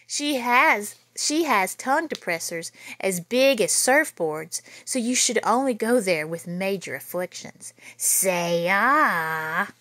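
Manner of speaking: lively read-aloud storytelling voice; story narration. A narrator's reading. Teacher reading a spooky school story to children, lively. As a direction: read it out big and lively for the kids. Reads picture book to kids.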